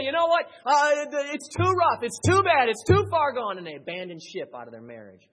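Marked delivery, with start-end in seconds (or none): 0.0-3.6 high, loud, exasperated complaint; a loud high despairing complaint; voicing a quitting spouse. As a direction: cry out like someone giving up. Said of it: "people say".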